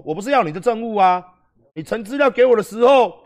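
the judge demands to know why the condom is missing, angrily; angry judge. Retold or imitated. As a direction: play the judge losing patience, scolding. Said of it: "那法官又生气啦".

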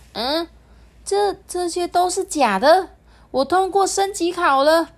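high, loud amazed realization; high amazed delight; surprised. Acted. Fox fairy realizes she passed.

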